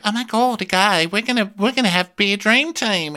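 high-pitched, hammed-up excitement; gushy, high mimicry; mock gushing lady. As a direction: imitate a woman squealing over a new teammate. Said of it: "the lady's like".

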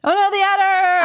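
high, loud mock panic; mock alarm. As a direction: cry it out in fake horror. Mock alarm at villain's return.

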